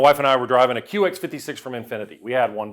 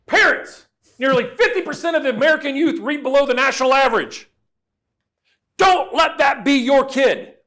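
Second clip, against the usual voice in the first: high, loud booming announcer voice imitating the radio spot; mock public service announcement read; 10 semitones higher, 5 dB louder than usual. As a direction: ham up a radio PSA pitchman warning parents. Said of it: "and he goes".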